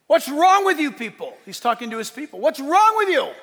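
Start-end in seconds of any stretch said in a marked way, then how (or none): 0.1-1.4 loud, raised-pitch prophet's scolding; exasperated rebuke. Acted. Elijah confronting his straying people, exasperated rebuke. 2.4-3.3 high, loud prophet's outburst; indignant shouted rebuke. Acted. Elijah scolding idol worshipers, indignant shouted rebuke.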